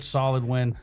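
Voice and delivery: normal talking voice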